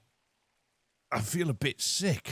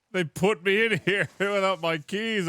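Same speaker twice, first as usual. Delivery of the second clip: usual speaking voice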